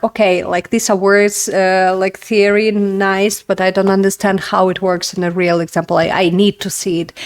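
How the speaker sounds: ordinary delivery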